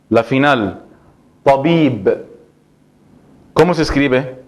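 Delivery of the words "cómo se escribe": usual speaking voice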